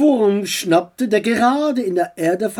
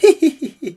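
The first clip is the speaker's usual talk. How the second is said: high short giggle; 9 semitones higher, 7 dB louder than usual. Acted. Aunt giggling beside the two, high giggle. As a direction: giggle at the uncle.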